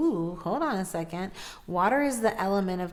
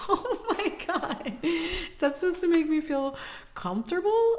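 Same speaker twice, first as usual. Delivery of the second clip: laughing disbelief; 10 semitones higher than usual. Real, not acted. Laughing at the reading.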